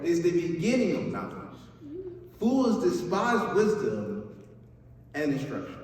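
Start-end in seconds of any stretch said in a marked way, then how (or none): none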